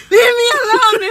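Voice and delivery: very high, very loud mock yell; imitating a boyfriend's outburst at full volume; exasperated shout. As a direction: shout it like someone pestered past patience. Imitates boyfriend snapping when pestered.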